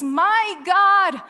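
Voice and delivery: a desperate shout of abandonment; loud anguished cry. Acted. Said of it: "cries out with a loud voice".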